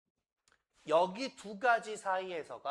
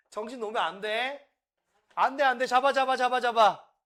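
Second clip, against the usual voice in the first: mock panic; 8 semitones higher, 11 dB louder than usual. Teacher's mock panic aside.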